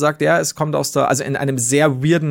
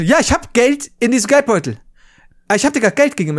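higher, louder, exasperated retort; retold irritation; 8 semitones higher, 3 dB louder than usual. Man scolding a pushy beggar, higher and louder in retold anger. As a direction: push back hard on the beggar's demand. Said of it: "dann bin ich sauer geworden".

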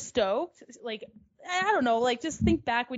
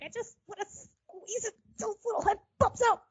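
imitating sister; 6 semitones higher than usual. Sister quoted about squeezing cats.

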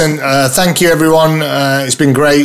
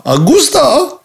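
high, drawn-out celebratory call; excited exclamation; 10 semitones higher, slower than usual. Acted. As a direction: sing out the name like a fanfare. Augusta repeated with flourish.

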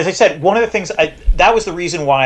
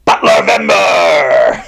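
drawn-out, loud announcer shout; playful announcement; 5 dB louder, much slower than usual. Pretend. Host hyping what's coming after next week, drawn-out mock-epic shout. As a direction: bellow it slowly, stretching the syllables.

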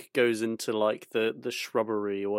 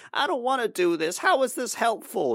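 whiny Luke; 12 semitones higher than usual. Retold or imitated. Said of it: "Luke's like".

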